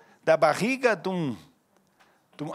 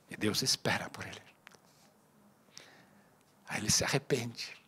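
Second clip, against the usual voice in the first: hushed solemn preaching; 7 semitones lower, 5 dB quieter than usual. Acted. Pastor softly telling the church of David's fall, hushed. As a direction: draw the church in close and quiet. Sober lowered preaching stretch.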